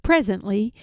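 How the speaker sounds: plain reading voice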